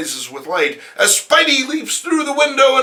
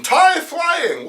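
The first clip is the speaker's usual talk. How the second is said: plain reading voice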